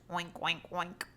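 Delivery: lightly voiced pig noises; oinking pig. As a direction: oink like a little pig. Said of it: "Pigs oinked".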